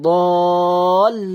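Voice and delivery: বলিন very long and loud, l joined to বল; lam joined. Shown as a demonstration. Teacher explaining the join, বলিন stretched and joined. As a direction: hold both halves of বলিন, lam closing the first. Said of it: "মানে লামটা যুক্ত হবে".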